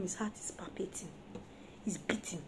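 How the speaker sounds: hushed whisper of dread. Real, not acted. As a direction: breathe it like a secret. Describing the husband's dread.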